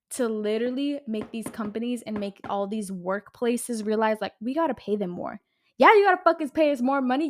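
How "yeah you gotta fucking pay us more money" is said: louder and higher, furious at the bosses; angry demand. Real, not acted. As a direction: shout it at the companies. Ranting at greedy companies.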